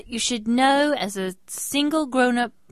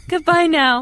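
much higher, louder laughing farewell; laughing goodbye; 9 semitones higher, 9 dB louder than usual. Retold or imitated. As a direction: toss off goodbye through a laugh. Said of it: "And I said".

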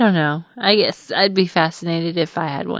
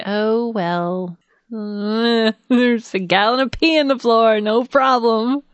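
higher, slow, sarcastic calm; laughing mock calm about the mess; mock breezy; 4 semitones higher, slower than usual. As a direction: pretend not to mind. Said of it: "I should have just been like".

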